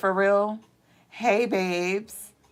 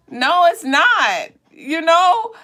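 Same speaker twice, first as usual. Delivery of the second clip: emphatic denial; 9 semitones higher, 4 dB louder, much slower than usual. Real, not acted. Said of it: "rejecting the doubts".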